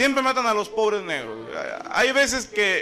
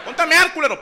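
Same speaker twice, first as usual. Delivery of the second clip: angry yell; 11 semitones higher, 5 dB louder than usual. Acted. Killer yells at the intruder.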